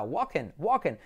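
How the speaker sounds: "walking" as WAH-ken; pronunciación correcta. Shown as a demonstration. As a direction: silent l, short e in "walking". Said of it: "Es como Joaquín". ("walking" como «guaken»)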